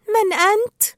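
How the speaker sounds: high, loud query; startled question. A narrator's reading. Dory cannot see who speaks, high startled question.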